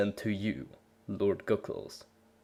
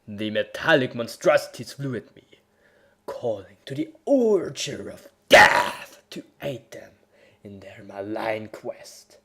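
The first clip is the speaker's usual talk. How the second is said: dramatic story reading; 3 dB quieter than usual. A narrator's reading. Reading his fanfic aloud, overblown epic narration. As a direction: read it like a hammed-up battle saga.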